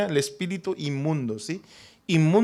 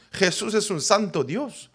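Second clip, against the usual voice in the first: normal talking voice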